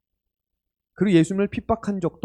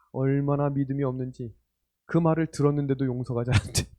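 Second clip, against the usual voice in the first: ordinary talk, nothing marked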